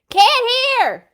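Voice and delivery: loud, high shout; shouting Papa. Acted. Papa yelling over the noise of the rocks, loud shout. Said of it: "shouted Pa".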